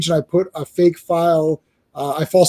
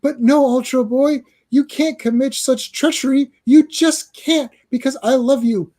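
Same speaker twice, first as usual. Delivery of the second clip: pleading high voice; 7 semitones higher than usual. Acted. Phantom Girl pleads.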